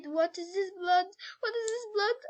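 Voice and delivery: high alarmed question, climbing on the repeat; frightened question. A narrator's reading. Scared girl questioning the blood on the floor, frightened.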